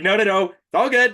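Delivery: high, loud mock cheer; no-no-no it's fine, chirped; reassuring brush-off. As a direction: wave off the worry brightly. Retelling reply to friend's concern.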